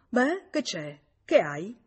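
loud, startled questions; a touch of fright; frightened. A narrator's reading. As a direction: ask in sudden alarm. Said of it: "Domandò spaventato".

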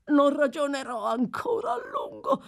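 high, tearful reply; a doomed old man. Acted. (risposta acuta e piangente)

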